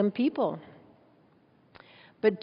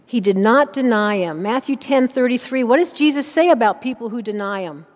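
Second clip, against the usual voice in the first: louder pulpit declaration, punching not; loud preaching; 7 dB louder than usual. Acted. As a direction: proclaim it to the whole room.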